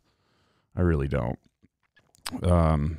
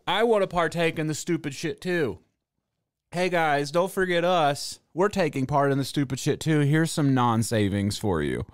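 higher, cheesy corporate sales voice; mock company voice; 5 semitones higher than usual. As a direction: ham up a desperate company ad. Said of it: "as a company, being like".

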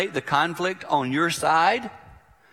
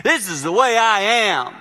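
stubborn mock shout; 7 semitones higher, 7 dB louder than usual. Acting stubborn old self.

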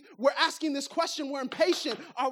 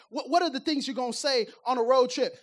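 ordinary talk, nothing marked

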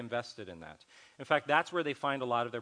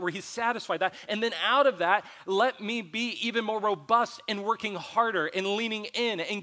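lifted, earnest prayer voice, a bit higher; retold prayer; 6 semitones higher, 7 dB louder than usual. Pastor retelling his own prayer to God, earnest and raised. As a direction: pray it out as he did alone. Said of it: "I'm just praying through and going".

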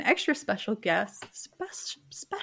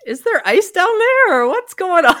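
high, loud joking question; laughing, teasing shout; playful exclaim; 10 semitones higher, 9 dB louder than usual. Real, not acted. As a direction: tease her with mock disbelief. Joking question to Aussie guest.

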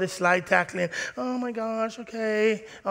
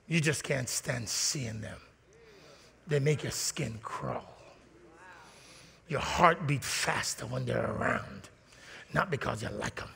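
hushed disgust; 7 semitones lower, 10 dB quieter than usual. Acted. Preacher to church, voicing quiet disgust for someone. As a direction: let the contempt seep out in a near whisper.

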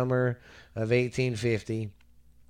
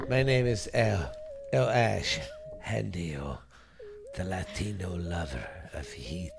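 sexy lover voice; 5 semitones lower than usual. Pretend.

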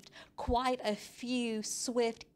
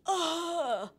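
long, high mock groan; anguished teen groan; 9 semitones higher than usual. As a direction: let out a hammed-up teenage groan. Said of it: "To express their anguish".

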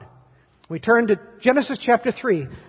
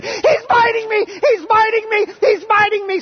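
high-pitched imitation of a screaming little girl; loud, very high retold scream; 13 semitones higher, 5 dB louder than usual. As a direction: shriek it out as the panicking child. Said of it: "she stood there screaming".

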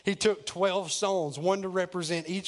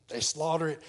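ordinary talk, nothing marked

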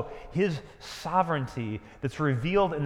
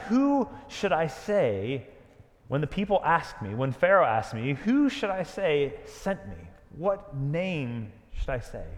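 slow pleading question. Acted. Moses asking God, slowed.

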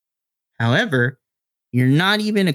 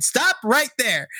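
higher, slow firm plea; embarrassed son; 11 semitones higher, slower than usual. Acted. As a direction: stop her mid-story. Diego cuts mom off.

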